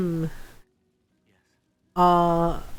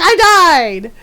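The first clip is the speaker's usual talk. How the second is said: excited squeal; 6 semitones higher, 10 dB louder than usual. Retold or imitated. Quoting friend's flustered exclamation.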